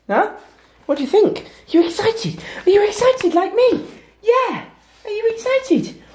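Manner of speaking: very high, sing-song voice for the dogs. Real, not acted. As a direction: coo at the dogs like they're babies. Talking baby-talk to the dogs.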